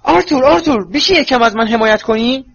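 high, loud, vexed appeal; upset with husband. A narrator's reading. Wife upset with her husband asks for backing, vexed and high-pitched. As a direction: call out sharply for his support. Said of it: "معلوم بود از دست شوهرش ناراحت است".

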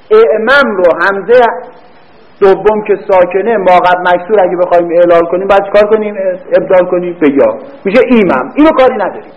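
ordinary talk, nothing marked

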